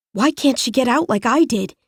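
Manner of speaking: ordinary delivery, nothing marked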